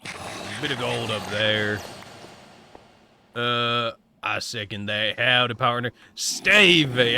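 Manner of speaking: lightly voiced southern cowboy; a hint of a southern cowboy accent. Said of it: "talking like this".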